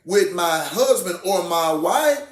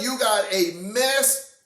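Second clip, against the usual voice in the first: ordinary talk, nothing marked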